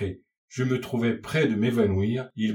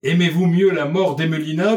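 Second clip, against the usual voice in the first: loud, high desperate question; acted distraught appeal, pitched up and loud; anguished outcry; 6 semitones higher, 7 dB louder than usual. A narrator's reading. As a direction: cry out in alarm, voice climbing at the end. Mother pleads for her daughter's life.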